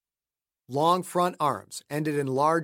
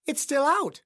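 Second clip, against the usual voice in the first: higher wife's voice, worried; 12 semitones higher than usual. Acted. Wife reporting the dead phone line, worried. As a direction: say it pitched up and tense.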